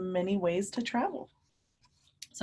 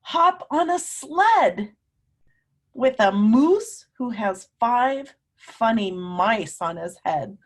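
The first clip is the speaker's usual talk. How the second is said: storybook reading; 5 dB louder, slower than usual. Acted. Teacher reading to children, slow and lilting. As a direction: stretch it out for little listeners. Reads story line dramatically.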